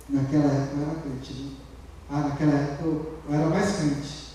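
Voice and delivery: low, soft, quick imitation of backsliders; mock wistful murmur. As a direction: mumble it like someone reminiscing weakly. Voicing people who dwell on the past.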